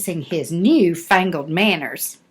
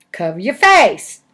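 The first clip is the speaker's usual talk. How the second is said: ordinary delivery, nothing marked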